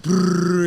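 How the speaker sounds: loud rolling brrr of gunfire; imitated gunfire. Retelling the shooting at the bar to the hosts, loud gunfire rattle. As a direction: rattle it out like shots. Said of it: "I'm hearing, like".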